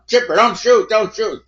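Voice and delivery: retold desperate don't-shoot scream; panicked yell. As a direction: yell it like a gun is pointed at you. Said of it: "he's yelling".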